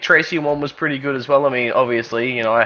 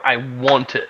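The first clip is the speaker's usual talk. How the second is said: ordinary talk, nothing marked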